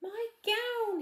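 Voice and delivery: high, loud delighted shout; joyful yell. Acted. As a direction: shout with joy at finding it. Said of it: "he yelled".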